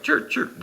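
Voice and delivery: high, unvoiced little whistle chirps; two short whistled chirps, pitched high; imitated whistle chirps. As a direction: copy the laughing ref tooting his whistle. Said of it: "he's kinda whistling".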